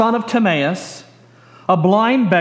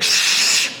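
hushing. Acted. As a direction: hush someone with a drawn-out hiss. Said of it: "those who were around said".

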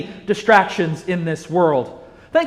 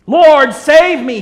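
loud, slow desperate cry for help; 6 semitones higher, 8 dB louder, slower than usual. Acted. Sinking Peter cries out loud to the Lord. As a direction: call out like a drowning man. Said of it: "he cried out".

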